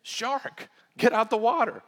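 high, loud yell of alarm; shouted warning. Shown as a demonstration. Lifeguard yelling to swimmers to get out, loud. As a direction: call out a shark warning. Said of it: "You shout it".

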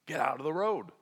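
ordinary talk, nothing marked

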